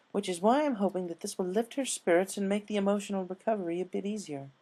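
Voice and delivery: hopeful seamstress fussing over her gift; Rarity's voice. A narrator's reading.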